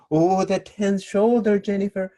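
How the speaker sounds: high, slow, syrupy fake-sweet jab; aggressive sweetness. Aggressive dancer needling Jennifer, mock-sweet high drawl. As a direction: smile while you needle her. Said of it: "With this attitude".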